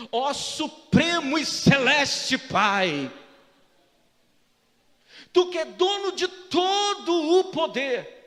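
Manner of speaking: booming, slow mock-grand prayer; pompous religious prayer. Pastor showing off fancy prayer for the sick brother, pompous. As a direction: proclaim it hugely, stretching each word. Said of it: "se você muda a entonação".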